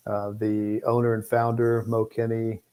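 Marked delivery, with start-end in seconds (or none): none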